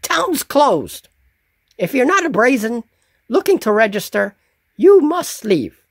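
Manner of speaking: high goblin voice, quick and curt; goblin guard. Acted. Goblin guard turning the travellers away, high curt goblin voice. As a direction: shoo them off like a petty goblin official. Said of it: "the town's guard says".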